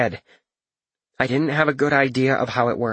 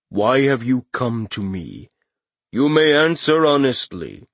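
booming Katashi voice. Acted. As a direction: speak down to a mortal, slowly. Katashi questions Corin.

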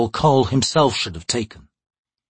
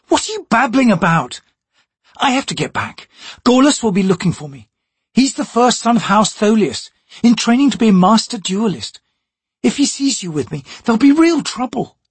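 scornful noblewoman; 11 semitones higher than usual. Acted. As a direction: talk down to the thief. Chalice impatient.

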